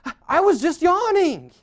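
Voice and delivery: high, loud, stammered mock protest; exasperated self-defence. Spouse accused of sighing, loud exasperated denial. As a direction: cry innocence at the top of the voice.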